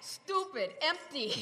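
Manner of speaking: ordinary delivery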